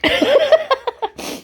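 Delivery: sudden high squeal of laughter; burst of laughter. Real, not acted. Laughing at the broken light, high squealing laugh. Light turns out not to work.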